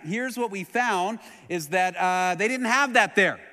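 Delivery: loud, high, ringing preacher's voice; climbing sermon voice, loud and high; full-force preaching. Acted. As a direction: preach it out to the back row.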